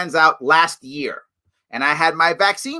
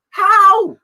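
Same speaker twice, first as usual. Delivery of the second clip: mock gasp and cry; 14 semitones higher, 4 dB louder than usual. Mock outrage at distribution question.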